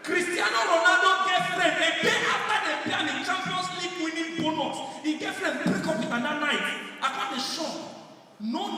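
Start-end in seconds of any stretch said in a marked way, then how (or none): 0.0-6.6 excited. Acted. Excited story build-up.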